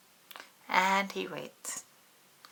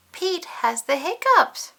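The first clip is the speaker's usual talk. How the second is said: excited announcing; 10 semitones higher, 8 dB louder than usual. A narrator's reading. Dramatic announcement.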